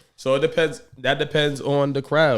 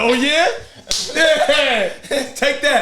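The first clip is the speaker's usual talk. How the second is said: high, loud mock gloat; gleeful taunt; 11 semitones higher, 3 dB louder, much slower than usual. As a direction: crow over the slap, pitched up high. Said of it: "I'd have been like".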